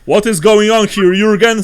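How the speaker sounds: loud, high stern question; demanding soldier. Acted. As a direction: demand answers from the innkeeper. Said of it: "says one of the soldiers".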